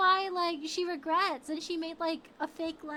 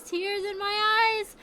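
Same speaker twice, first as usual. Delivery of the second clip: slow, higher, louder lament; tearful cry; 7 semitones higher, 9 dB louder, slower than usual. Real, not acted. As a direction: wail the repeat.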